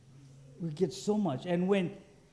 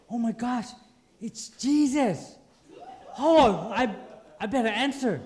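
higher, louder acted excitement; thrilled phone reaction; 5 semitones higher, 5 dB louder than usual. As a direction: react as if the most important caller is ringing. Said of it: "you get your phone call".

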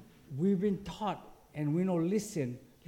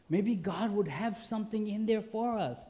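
ordinary delivery

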